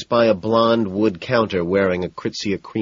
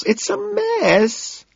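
high, drawn-out dramatic wail; much slower than usual. A narrator's reading. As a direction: wail it in mock despair. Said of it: "she wails".

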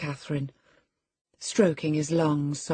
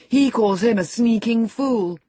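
put-on loud, higher boy's jeer; scornful taunt; 8 semitones higher, 7 dB louder than usual. A narrator's reading. Boy insulting his cousin's father, loud and scornful. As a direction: hurl the insult, pitch up. Linton's taunt in acted quarrel.

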